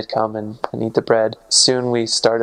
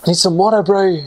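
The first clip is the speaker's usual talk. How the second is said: higher Kiwi-style bro, lightly done; Kiwi bro talk; 6 semitones higher, 3 dB louder than usual. Shown as a demonstration. Explaining New Zealand slang, a light Kiwi accent. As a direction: toss out a casual Kiwi bro. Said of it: "It's like".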